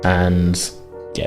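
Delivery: ordinary talk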